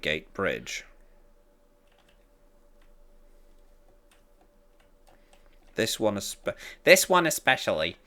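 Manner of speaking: reading a friend's text lines as a Tim Conway-ish figure; lightly voiced Tim Conway. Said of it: "making you sound like Tim Conway".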